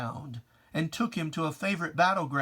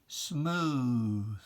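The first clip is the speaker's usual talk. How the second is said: normal talking voice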